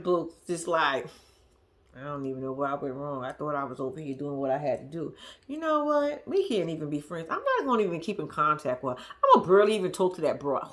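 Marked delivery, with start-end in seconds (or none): 2.0-10.7 low, soft, fast sulky mutter; dejected Noble sulking over the breakup; mopey Noble voice. Retold or imitated. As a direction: play the hurt ex grumbling to himself. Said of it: "That's the vibe Noble giving me".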